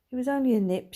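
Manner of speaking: ordinary delivery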